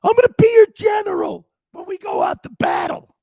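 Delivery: squealing, tearful mock locker-room rally cry; coach in tears. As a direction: imitate a coach crying and shouting to his team. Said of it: "Here's Marty in tears".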